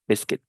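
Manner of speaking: biscuit said "BIS-kit"; correct pronunciation. Shown as a demonstration. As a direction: silent U in biscuit. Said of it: "La U y la I suenan I".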